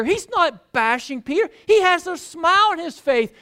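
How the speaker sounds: impassioned sermon delivery; fervent preaching. Acted. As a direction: drive the point home to the congregation. Preaching at full force.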